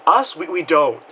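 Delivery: normal talking voice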